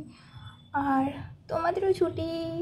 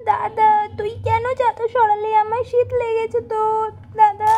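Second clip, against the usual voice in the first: put-on a higher, louder child's complaint at her brother; whiny little girl; 4 semitones higher, 7 dB louder than usual. Little sister complaining to her brother about the sheet, whiny. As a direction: whine like a cold little sister.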